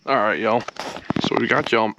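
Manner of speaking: talking around screws in mouth; words blocked by a mouthful; mouth full. Real, not acted. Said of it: "Sorry, I've got screws in my mouth".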